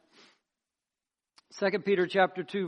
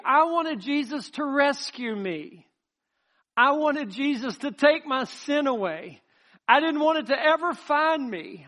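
raised, louder preacher's voice building with fervor; full-force preaching; 5 semitones higher, 4 dB louder than usual. Acted. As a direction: preach it out with passion, higher and louder.

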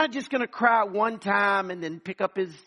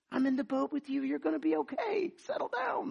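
soothing reassurance; 5 semitones higher, 6 dB quieter than usual. Acted. Jesus calming the disciples in boat.

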